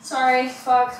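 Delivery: ordinary talk, nothing marked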